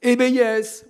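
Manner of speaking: a pupil finally blurting the answer; hesitant pupil. Retold or imitated. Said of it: "il y avait le silence dans la salle".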